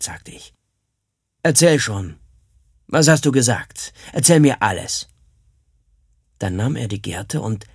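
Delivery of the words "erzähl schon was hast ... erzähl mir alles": drohender Krieger. Acted. Krieger verhört den Jungen drohend.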